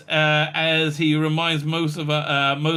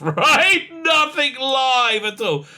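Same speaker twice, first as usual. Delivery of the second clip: exasperated shout; 9 semitones higher, 4 dB louder, much slower than usual. Real, not acted. Mocking the lack of promotion.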